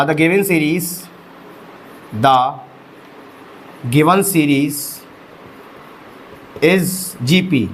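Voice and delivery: spaced-out phrases at writing speed; slow dictation. Real, not acted. Dictating the written answer slowly.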